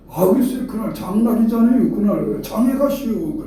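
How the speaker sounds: mimicked Chungcheong excuse. Preacher mimics a no-show blaming market day, high Chungcheong excuse. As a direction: wriggle out of it, Chungcheong style. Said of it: "충청도 사람은 그래 놓고는".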